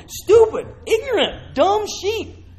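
high, loud, incredulous stress on each insult; mocking emphasis. Real, not acted. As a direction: spit out the insults in disbelief. Mocking dictionary word for sheep.

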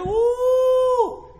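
long, high "woo"; admiring whoop. Real, not acted. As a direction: whoop over the fine outfit. Whoops over nice dresses.

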